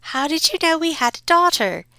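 wondering mother. Acted. Mother asks the Count, astonished. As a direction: gasp the question. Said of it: "Buttercup's mother wondered".

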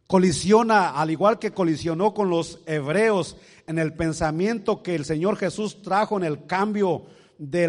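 loud, driving sermon voice; full-force preaching. Acted. Pastor preaching loudly to the congregation. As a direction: press the point hard to the congregation.

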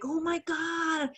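retold happy amazement; overjoyed little boy. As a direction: imitate a thrilled kid, stretching the words. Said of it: "the little boy was so happy".

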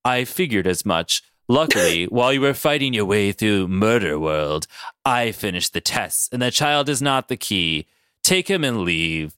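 low, unhurried villain dismissal; Mister Sinister. Acted. Sinister gives up the child, low and dismissive. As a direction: dismiss them in a deep, composed voice. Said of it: "And Sinister goes".

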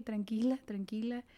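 fading murmur to calm yourself; soothing herself softly. Retold or imitated. Calming herself down while choking, fading soft. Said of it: "empecé como a ver".

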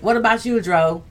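ordinary talk, nothing marked

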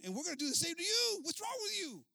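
very high, loud, threatening rant; angry yelling. Acted. Chief threatening the murdered man's wife, angry yelling. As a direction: push the voice high and loud in outrage. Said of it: "he starts yelling at her".

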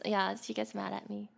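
ordinary talk

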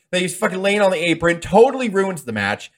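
ordinary delivery

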